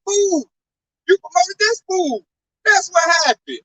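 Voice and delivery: high, scolding put-down; scornful retort. Retold or imitated. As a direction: talk down to them like fools. Scolding the bosses.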